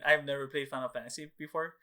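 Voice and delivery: ordinary talk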